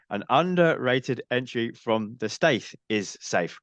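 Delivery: every word of "An underrated entry from the Staith is safe" spelled out slowly, settling on a fall; slowly, deliberately, to finally get it right; slow and careful. Shown as a demonstration. Co-host reading the tricky title slowly after stumbling. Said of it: "Wow, now I'm doing it".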